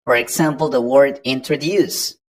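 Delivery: ordinary talk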